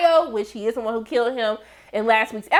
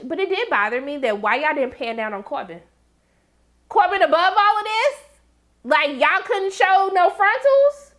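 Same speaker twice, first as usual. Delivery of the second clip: normal talking voice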